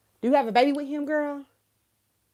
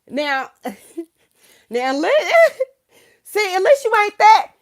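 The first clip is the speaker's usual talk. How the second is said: laughing; 8 semitones higher, 9 dB louder than usual. Real, not acted. Laughing at her own remark.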